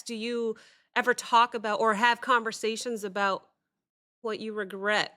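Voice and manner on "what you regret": usual speaking voice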